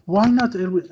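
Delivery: high, loud indignant comeback as Patrick; mock Patrick retort. Patrick answering the scolding, high, loud, indignant. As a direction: toss back a defiant why not.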